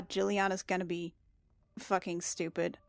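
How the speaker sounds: ordinary delivery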